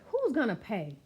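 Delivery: plain reading voice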